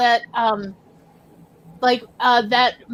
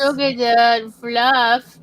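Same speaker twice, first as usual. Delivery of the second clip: cooing at a pet; 6 dB louder, much slower than usual. Real, not acted.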